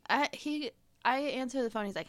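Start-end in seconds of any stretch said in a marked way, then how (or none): none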